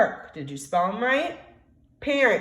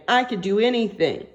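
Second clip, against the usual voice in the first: usual speaking voice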